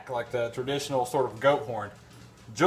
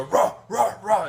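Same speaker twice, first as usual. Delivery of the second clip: high yappy barking, higher pitch; dog bark imitation; 5 semitones higher than usual. Man imitating his bulldog barking at the goat. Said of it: "she'd go".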